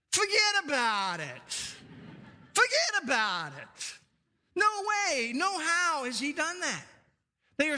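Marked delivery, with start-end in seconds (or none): 0.1-3.9 put-on playful New Jersey accent. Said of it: "In Jersey, they would put it this way". 4.6-6.9 much higher New Jersey tough-guy No way, no how; playful mock New Jersey accent. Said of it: "In Jersey, they would put it this way".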